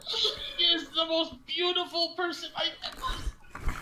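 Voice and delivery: high, giggling defense of the wife; laughing in character. Acted. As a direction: try to keep a straight face and fail. Player defends goblin's wife in character.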